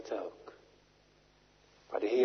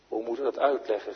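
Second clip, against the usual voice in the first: ordinary talk, nothing marked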